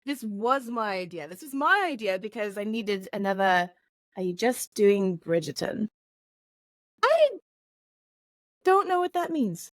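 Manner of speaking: put-on Mary Poppins style British accent; American faking a posh English accent. Co-host admitting the idea was hers, in mock British.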